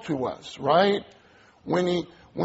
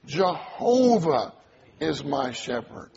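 "Jehovah" drawn out slowly, then the rest drops away; stress on Jehovah. Shown as a demonstration. Preacher showing how to pray-read a verse, stress on "Jehovah". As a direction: stretch and punch "Jehovah", let the rest fall. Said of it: "We can even emphasize different words".

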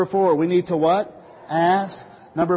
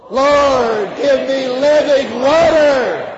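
much louder, higher and slower declaration; mean it; 7 semitones higher, 10 dB louder, much slower than usual. Shown as a demonstration. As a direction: declare it with full force this time. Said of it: "say it again and mean it".

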